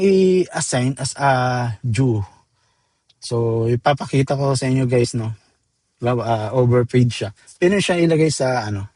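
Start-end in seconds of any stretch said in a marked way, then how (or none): none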